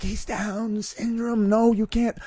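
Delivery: high, slow frantic whisper-warning; high alarmed cut-off; host stopping guest in panic, high. Retold or imitated. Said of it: "Bob cuts him like".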